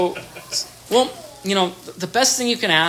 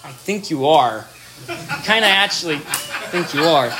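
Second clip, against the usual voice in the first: 1 know-it-all retort. Retold or imitated. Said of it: "where you go".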